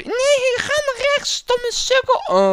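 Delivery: very high, loud shrieked scolding; hammed-up furious damsel; scolding falsetto princess. Princess scolding player for wrong turn, angry falsetto.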